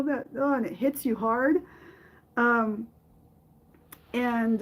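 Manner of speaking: usual speaking voice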